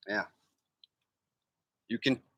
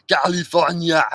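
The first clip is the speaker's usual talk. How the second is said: Arnold California. Shown as a demonstration. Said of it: "the next one you say".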